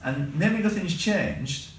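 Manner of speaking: ordinary delivery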